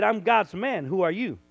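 loud, bold comeback; loud retold retort to a heckler; defiant retort. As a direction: stand your ground, loudly. Preacher answering the challenger.

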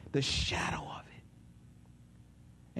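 slow ominous whisper. Acted. Preacher describing the valley's shadow, slow whisper. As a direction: breathe it out like something sinister. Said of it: "ominous shadow".

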